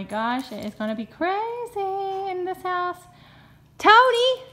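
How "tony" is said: loud higher call. Real, not acted. Owner calling her dog from afar. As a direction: call across the house.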